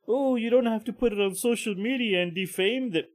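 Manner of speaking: high, whiny mock of online critics. Mocking people who say don't post videos, high whiny voice. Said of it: "A lot of people say".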